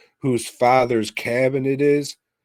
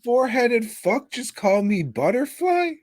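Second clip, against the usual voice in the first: much higher, mocking girly voice; mock character quote; 3 dB louder than usual. Quoting a film character to his friend, high mock voice. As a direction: squeak it out like an outraged character.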